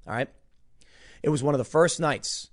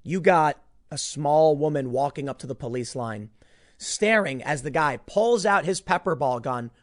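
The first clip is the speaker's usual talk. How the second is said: usual speaking voice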